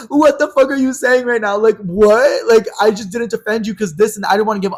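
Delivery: high, loud imitation of an outraged friend; mock indignant comeback. Pissed-off friend answering an accusation, indignant mock retort. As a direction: throw it back high and loud, mimicking her.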